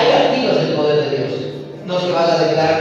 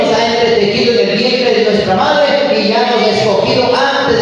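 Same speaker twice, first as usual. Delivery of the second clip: mock warfare prayer; 5 semitones higher, 5 dB louder than usual. Shown as a demonstration. Modelling a mother's warfare prayer.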